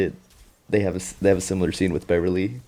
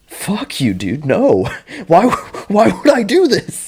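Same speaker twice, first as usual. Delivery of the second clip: much higher, louder incredulous shout; mock outraged Dick; 13 semitones higher, 9 dB louder, slower than usual. Dick refuses Leroy's request, high loud mock outrage. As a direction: refuse in squeaky disbelief.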